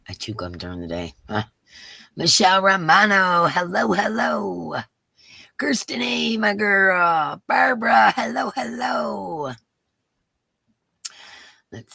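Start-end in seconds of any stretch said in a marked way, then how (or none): 2.2-9.5 calling out. Real, not acted. Calling out greeting.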